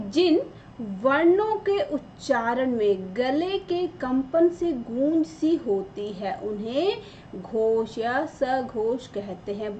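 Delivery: slow, deliberate reading of the definition; slow textbook read. Shown as a demonstration. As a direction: read it out slowly so the students can follow. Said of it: "बहुत ही clear शब्दों में लिखा है".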